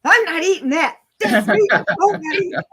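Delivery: loud, high mock furious refusal; furious child. Angry little boy refusing the meatloaf cake, loud and high. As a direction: stamp it out like an outraged toddler. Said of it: "He is so mad".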